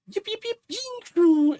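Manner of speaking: high-pitched yips ending in a loud ying; rapid high creature yelps; Carbine. Acted. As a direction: yip excitedly, then sail off on a loud note. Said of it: "you can hear Carbine ahead of you".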